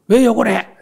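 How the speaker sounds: high, loud mock-angry shout; hammed-up indignant yell; quarrelsome retort. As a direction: act out snapping back at an insult. Acts out being drawn into quarrel.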